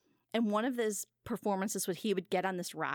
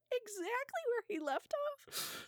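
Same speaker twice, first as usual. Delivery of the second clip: high, slow, laughing through the words; 5 dB quieter, slower than usual. Real, not acted. Delivering the record punchline to Jimmy, laughing helplessly. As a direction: squeak it out mid-laugh.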